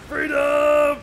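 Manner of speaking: a high, loud yell of release; triumphant shout. Real, not acted. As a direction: shout it as you break free. Escaping the horde on bike.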